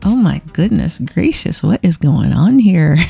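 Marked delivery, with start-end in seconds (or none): none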